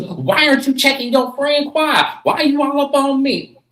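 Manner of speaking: high, loud indignant yelling; Simone yelling back. Retold or imitated. Simone yelling at Angel about Quad, high and loud. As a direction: shout it back, offended. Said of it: "She goes".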